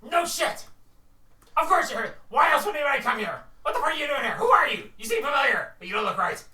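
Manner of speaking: high, loud kobold rant calming toward the end. Acted. Kobold rants at the party at his door, high-pitched. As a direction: rattle it off high and indignant.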